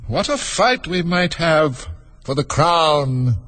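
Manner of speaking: drawn-out, scheming creature voice; sly. A narrator's reading. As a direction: linger slyly over the words. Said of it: "looking slyly up at the crown".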